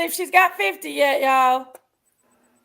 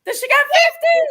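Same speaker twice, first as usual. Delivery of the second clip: excited shout; 11 semitones higher, 6 dB louder than usual. Real, not acted. Goal reached, everyone cheering.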